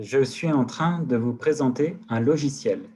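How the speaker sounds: slow, loud, deliberate words for the microphone; slow dictation. Shown as a demonstration. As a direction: spell it out slowly so the software catches it. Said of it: "Il m'écoute encore?".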